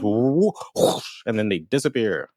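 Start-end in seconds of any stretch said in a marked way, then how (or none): 0.0-1.2 airy whoosh of erupting flame; imitated whoosh. Player recaps blue flame erupting round bodies, imitated whoosh. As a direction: make the flame's rushing noise. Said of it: "and it goes".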